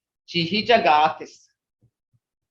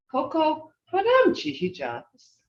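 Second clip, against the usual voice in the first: clearly higher, felt Muskogee goodbye; heartfelt; 7 semitones higher than usual. Shown as a demonstration. Teacher contrasting the heartfelt Muskogee goodbye for the class. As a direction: lift it with real feeling. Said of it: "Hear that?".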